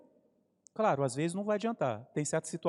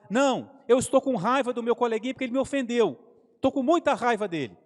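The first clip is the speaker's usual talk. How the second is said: put-on angry child; 6 semitones higher, 6 dB louder than usual. Angry boy answers his father.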